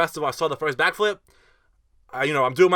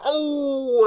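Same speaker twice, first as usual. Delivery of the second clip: long, high "oh" of a shocked crowd; imitated crowd gasp; 10 semitones higher than usual. Crowd watching him face plant, imitated shocked cry. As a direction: mimic onlookers seeing a bad fall.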